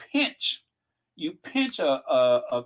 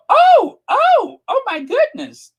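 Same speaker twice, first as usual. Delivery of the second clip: very high, loud mock shock; hammed-up oh my goodness; fake surprise; 10 dB louder, slower than usual. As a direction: pretend to gasp in alarm. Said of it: "and then go".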